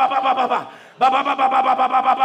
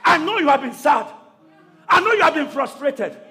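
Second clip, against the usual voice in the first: shouted sermon, rising with the crowd; fiery preaching; 4 semitones higher than usual. Acted. Preacher shouting to congregation, full force.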